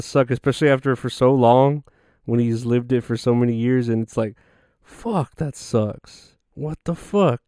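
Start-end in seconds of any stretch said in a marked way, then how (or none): 4.8-7.4 soft, bummed-out cursing; acted quiet disbelief; mock dismay. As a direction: curse under it softly, pitch jumping high then dropping. Acted reaction to losing pizza place.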